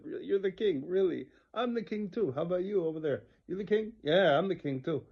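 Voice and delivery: loud, high-pitched scoffing; mocking disbelief. Forest men laughing at the self-proclaimed king, mocking. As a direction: jeer at a man claiming a crown. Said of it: "And they laughed. They said".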